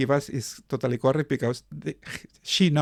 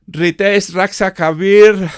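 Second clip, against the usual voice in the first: loud, raised roll call; 5 semitones higher, 11 dB louder, slower than usual. Real, not acted. Host calling participants to speak, calling out. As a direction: call the names out loudly, pitched up.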